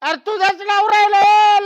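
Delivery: high, loud protest; exasperated insisting. Acted. Drunk man insisting to woman he is her husband, loud plea. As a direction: wail it out to convince her.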